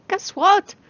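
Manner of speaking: high, loud, bursting guess what; excited announcement. Acted. Loki running up to his human, loudly excited. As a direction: blurt it out like a kid with news.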